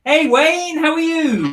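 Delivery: high, loud sing-song hello to Wayne; high greeting. Pretend. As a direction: pitch it way up and call out hello.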